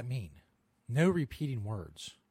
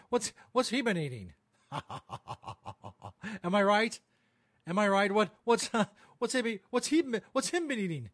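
mock local-news banter; 7 semitones higher than usual. Podcaster mocking local news anchors bantering over a fat raccoon, hammed-up and high. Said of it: "local news banter".

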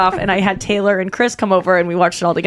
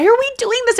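scared whine. Retold or imitated. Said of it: "we go".

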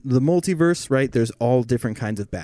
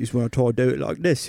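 quick British accent; faster than usual. Shown as a demonstration. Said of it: "the only time I can do kind of a real statty British accent".

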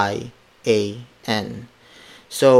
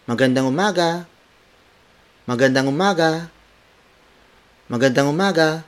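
higher, quick and bright "Magandang umaga"; cheery tone; 5 semitones higher, faster than usual. Shown as a demonstration. Teacher modelling a cheerful morning greeting, higher and quick. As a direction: greet someone happily at the start of the day. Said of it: "make it a cheery tone".

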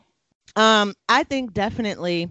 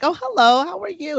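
friendly neighbor greeting; 10 semitones higher than usual. Retold or imitated. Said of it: "It's like".

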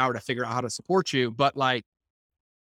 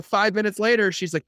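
normal talking voice

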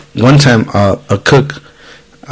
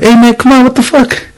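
retold kitchen yell; 13 semitones higher than usual. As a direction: act out calling a coworker out, voice shooting up. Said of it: "in the kitchens, people always yell".

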